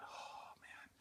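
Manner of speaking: breathy whispered inner groan; whispered thought. Retold or imitated. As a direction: whisper it to yourself, dismayed. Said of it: "I thought".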